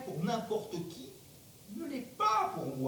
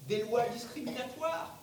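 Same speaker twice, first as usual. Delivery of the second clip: ordinary talk, nothing marked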